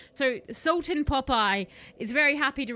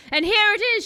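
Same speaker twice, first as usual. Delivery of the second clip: grand reveal; 10 semitones higher, 10 dB louder, faster than usual. Acted. Witch unveils her magic.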